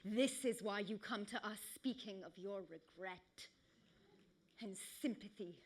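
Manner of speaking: low, hushed tragic monologue; grave, quiet reproach; Hecuba. Acted. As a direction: begin softly, weighing every phrase, with long pauses. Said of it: "Hecuba in Trojan War says".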